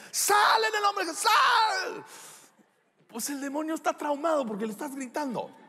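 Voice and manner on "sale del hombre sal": high, drawn-out yell mocking loud exorcists; mock shouted exorcism. People casting out a demon as if it were deaf, mock yelling. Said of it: "no tienes que gritar, los demonios no están sordos".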